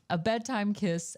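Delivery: ordinary talk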